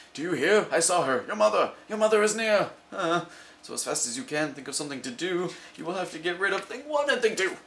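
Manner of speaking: a fish in a flap about mother; panicked fish. Acted. The fish warning mother is coming, high and frantic.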